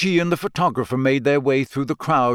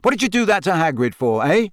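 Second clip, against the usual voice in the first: quick, higher angry demand; heated, fast challenge; furious; 6 semitones higher, 3 dB louder than usual. A narrator's reading. Harry demanding why Rita hurt Hagrid, furious. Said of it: "said Harry furiously".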